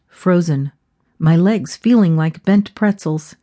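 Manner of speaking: ordinary delivery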